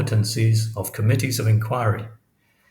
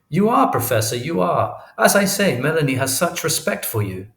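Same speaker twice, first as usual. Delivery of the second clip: pleading parent, pitched well up; earnest father; 6 semitones higher than usual. A narrator's reading. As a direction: press the point in a high, eager voice. Isaacs pleading with professor by phone.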